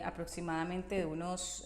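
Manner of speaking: ordinary delivery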